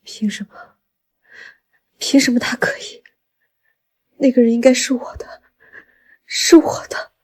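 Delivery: bitter, tearful whisper; 不甘低语. Acted. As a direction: whisper it to yourself, wounded and resentful. Jealous rival alone, whispering bitterly. (带着哭腔，不甘心地低声呢喃)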